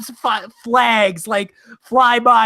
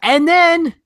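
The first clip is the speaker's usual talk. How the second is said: exasperated; 7 semitones higher, much slower than usual. Real, not acted. Exasperated at the cliffhanger.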